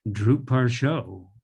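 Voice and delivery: drupar sho said slowly and carefully, every syllable clear; slow demo. Shown as a demonstration. Teacher models the final verb slowly for students. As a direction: spell it out slowly for the class. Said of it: "the verb comes at the end in Tibetan".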